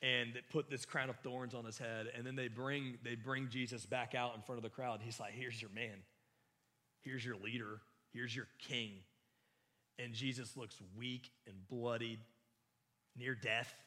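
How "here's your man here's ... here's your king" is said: sarcastic. Retold or imitated. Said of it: "very sarcastic of Pilate".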